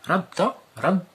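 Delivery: teacher drilling the shadda in رد; emphatic doubled r. Shown as a demonstration. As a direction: call out رد, pressing the shadda. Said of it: "teacher modelling shadda".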